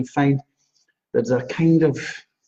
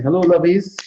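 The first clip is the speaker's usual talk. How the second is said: Sharon's greeting; 6 semitones higher, 6 dB louder than usual. Retold or imitated. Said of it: "Sharon saying".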